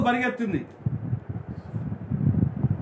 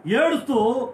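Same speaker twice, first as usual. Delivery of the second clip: impassioned preaching; 5 semitones higher than usual. Acted. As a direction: preach it with growing fire. Preacher building to climax.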